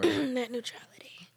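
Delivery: hushed aside after clearing throat; whispered. Real, not acted. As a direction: whisper the phrase.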